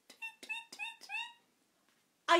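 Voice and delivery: very high chirpy tweets; bird tweet. Shown as a demonstration. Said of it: "Let's do their sounds".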